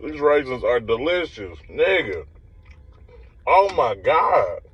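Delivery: savoring food mid-bite; talking while chewing. Real, not acted. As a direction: mouth partly full. Seen 2: puts cookie bits to mouth, lips working while speaking.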